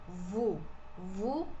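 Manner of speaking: vous pronounced "voo"; vous with silent S and a Portuguese U; plain "oo" sound. Shown as a demonstration. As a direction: say vous with a rounded back "oo". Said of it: "que tem o som de U".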